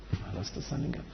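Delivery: breathy whispered repeat, no voice; hushed whisper. Retold or imitated. Whispering a bereaved father's words to the congregation. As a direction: drop to a bare whisper for the grief. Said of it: "repeated hushed".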